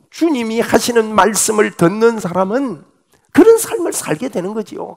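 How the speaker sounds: ordinary delivery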